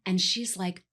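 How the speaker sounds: ordinary delivery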